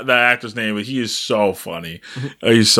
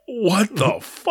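stunned what the fuck; 8 semitones higher, slower than usual. Retold or imitated. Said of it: "they're like".

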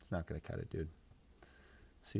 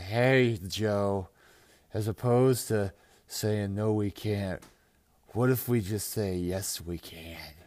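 hammed-up tipsy politician, louder and drawn out; mock drunk; 6 dB louder, slower than usual. Kamala teasing Joe at the debate, mock drunk. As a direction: imitate a drunk candidate heckling a rival. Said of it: "if she was fucking drunk".

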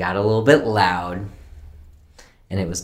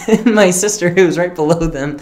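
laughing; 9 semitones higher, 8 dB louder than usual. Real, not acted. Still laughing at the story.

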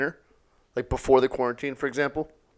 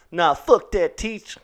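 defiant student; 6 semitones higher, slower than usual. Pretend. Voices defiant student in movie.

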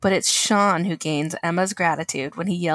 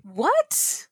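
surprised; 9 semitones higher than usual. Real, not acted. Surprised reaction.

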